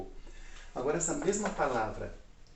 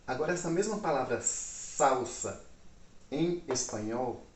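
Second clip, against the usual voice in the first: usual speaking voice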